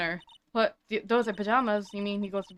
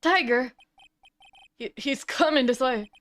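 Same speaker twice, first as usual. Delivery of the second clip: ordinary delivery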